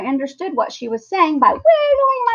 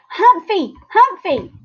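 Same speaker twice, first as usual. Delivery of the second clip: excited shout; 9 semitones higher, slower than usual. A narrator's reading.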